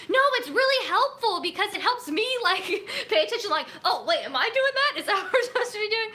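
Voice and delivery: put-on high, loud, eager girl voice; excited classmate. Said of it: "And she's like".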